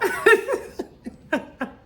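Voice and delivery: high, breaking-up laughter; real tickled laugh; amused laugh. Real, not acted. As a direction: crack up at your own imitation. Amused by her own imitation.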